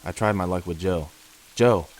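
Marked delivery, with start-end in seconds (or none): 1.6-1.9 Joe called out loud and sharp; crying out. Acted. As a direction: shout his name in alarm. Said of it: "I cried".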